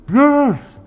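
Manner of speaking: high, loud call from underground; trapped woman's voice. Acted. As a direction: shout up from inside a coffin. Buried woman answers from grave.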